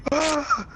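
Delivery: high mock scream; one short, high tripping scream; imitated scream. Friend answering with a scream when asked what's wrong. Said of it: "Fucking screaming".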